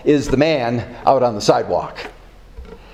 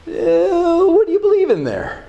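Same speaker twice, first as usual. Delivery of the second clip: high searching question; 14 semitones higher than usual. Acted. Preacher repeats the key question.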